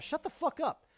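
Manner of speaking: high yelled shut the fuck up; angry shout. Real, not acted. Streamer cutting off Elon's Mars talk, high angry shout. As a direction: snap it out, pitch jumping up. Rant at Elon Musk.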